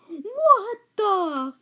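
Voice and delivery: high, loud mock outburst; mom's shocked scream. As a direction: imitate an outraged mom walking in. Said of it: "And she starts screaming".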